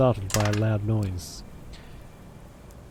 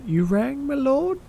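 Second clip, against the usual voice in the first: much higher, rising put-on voice; mock servant; 13 semitones higher, slower than usual. Joking to the kid about going gently, mock servant. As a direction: pitch it way up like a hammed-up servant.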